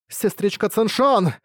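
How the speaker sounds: high, slow joyful cry of a name; excited cry. A narrator's reading. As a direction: call out her name, thrilled. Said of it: "вскричала она".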